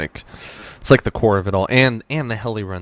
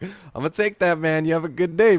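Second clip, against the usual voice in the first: breezy reply; 7 semitones higher than usual. Retold or imitated. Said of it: "I'm like".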